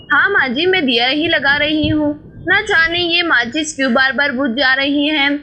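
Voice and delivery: ordinary delivery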